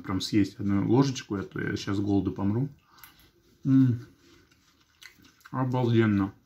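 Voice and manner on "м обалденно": talking between bites; muffled, lips barely open; mouth full, chewing. Real, not acted. Seen 0: Forkful taken at -1; lips pursed, chewing through the words.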